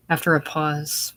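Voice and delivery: ordinary delivery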